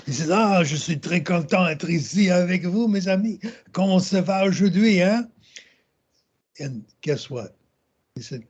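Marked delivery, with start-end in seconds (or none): 0.3-5.3 higher-pitched, hammed-up French accent; mock French accent, pitched up; exaggerated French accent. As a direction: make fun of French with an over-the-top French accent. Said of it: "was an exaggerated French accent".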